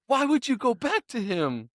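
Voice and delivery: very high, pleading disbelief; mock indignation. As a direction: wail at the TV in a high voice. Mock-yelling at TV drama.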